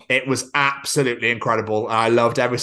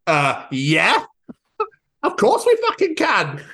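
high, laughing "of course we fucking can"; laughing delight; 10 semitones higher, slower than usual. Retold or imitated. Answering girlfriend about the match, high laughing delight. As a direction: burst out laughing with joy. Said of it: "answering girlfriend about watching rugby".

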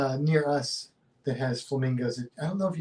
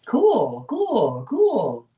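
rising high cooing "cool"; pigeon voice; 6 semitones higher, 5 dB louder, much slower than usual. Retold or imitated. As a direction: coo it like a pigeon. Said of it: "That was my pigeon impression".

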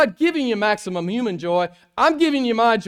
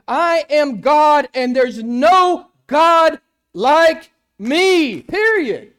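very slow, much higher and louder proclamation; voicing God; 9 semitones higher, 10 dB louder, much slower than usual. Preacher voicing God's claim, slow and booming. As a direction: stretch each word like a divine decree. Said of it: "he says".

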